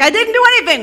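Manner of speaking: high, loud mock outburst; indignant protest. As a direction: protest innocence at the top of the voice. Job protests in acted debate.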